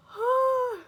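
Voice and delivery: high, loud shaky sigh; a loud, tearful sigh of relief; emotional sigh. Real, not acted. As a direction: let out an overwhelmed sigh. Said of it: "I'm still so emotional".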